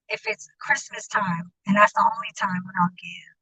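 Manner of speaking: hushed unvoiced whisper; whispering it close to the mic. Real, not acted. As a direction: drop to a whisper, confiding. Confiding aside.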